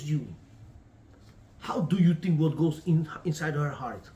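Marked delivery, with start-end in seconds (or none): none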